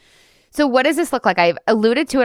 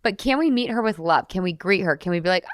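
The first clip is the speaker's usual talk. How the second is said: ordinary talk, nothing marked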